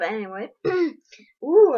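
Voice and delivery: ordinary delivery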